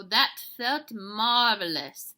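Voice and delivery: loud, slow, savouring delivery; sighing satisfaction. Acted. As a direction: stretch it out with relish. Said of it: "sighed Don Diego".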